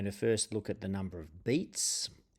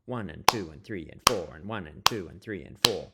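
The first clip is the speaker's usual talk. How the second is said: "two" and "four" punched louder in the count; stressed backbeat count; 3 semitones lower, 4 dB louder than usual. Shown as a demonstration. Teacher counts the strum pattern, louder on two and four. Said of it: "counting on those two and four beats".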